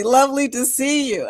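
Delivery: ordinary talk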